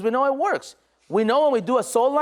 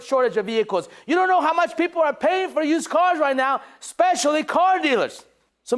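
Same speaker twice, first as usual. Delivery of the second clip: energetic, driving phone pitch in a raised voice; enthusiastic sales pitch; 4 semitones higher than usual. Shown as a demonstration. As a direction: pitch it with full conviction and energy. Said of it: "With enthusiasm, with energy".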